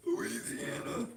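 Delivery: squeaky-high, slow mock Louisiana accent; absurdly high Louisiana drawl. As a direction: push the Louisiana twang up into a thin falsetto. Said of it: "What is the Louisiana accent?".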